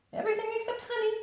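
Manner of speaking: very high, fast bouncy correction; quick chirpy Tigger. Acted. Tigger correcting Pooh at breakfast.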